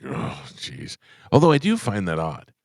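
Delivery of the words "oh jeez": weary sigh. Retold or imitated. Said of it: "he was just like".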